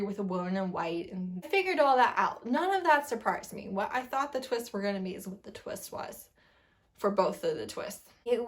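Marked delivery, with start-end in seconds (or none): none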